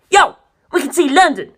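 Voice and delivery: high, loud youthful shout; excited call. Acted. Narancia shouting to the crew, high and excited. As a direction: call it out like you've spotted land.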